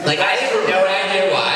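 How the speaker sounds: put-on high-pitched Valley Girl voice. Shown as a demonstration. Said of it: "is Valley Girl".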